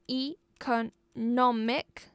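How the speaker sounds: economic in four slow, separate beats; syllable by syllable. Shown as a demonstration. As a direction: slowly split economic into e, co, nom, ic. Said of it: "four-syllable noun".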